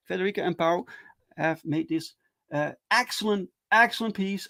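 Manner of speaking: ordinary talk, nothing marked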